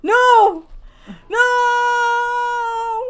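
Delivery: loud, high cries of no; anguished shout. Real, not acted. Gamer who just killed a dog shouts in mock despair.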